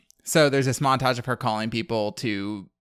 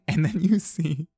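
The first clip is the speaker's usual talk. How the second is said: laughing while talking; 3 semitones higher, 5 dB louder, slower than usual. Real, not acted. Laughing while describing scene.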